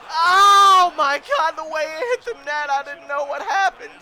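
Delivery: long loud wail, then high excited explanation; high, loud disbelief; shocked yell. Real, not acted. Shock at shot hitting net.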